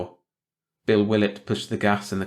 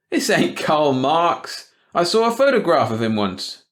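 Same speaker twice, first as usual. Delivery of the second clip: higher, louder, drawn-out scoff; scoffing shopkeeper; 8 semitones higher, 6 dB louder, slower than usual. A narrator's reading. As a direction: brush off the gossip's silly idea. Ed's voice, scoffing.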